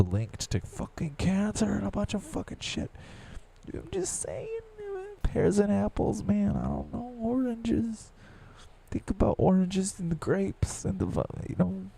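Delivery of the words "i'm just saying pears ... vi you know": much higher silly squeak; squeaky voice. Pretend. Rambling about fruit in a high squeak. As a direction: babble it in a cartoon squeak.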